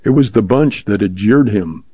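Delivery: ordinary delivery, nothing marked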